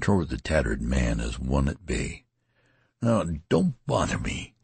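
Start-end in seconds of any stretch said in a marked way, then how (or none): 3.0-4.5 desperate menace. Acted. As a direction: warn him off, desperate and menacing. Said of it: "he repeated with desperate menace".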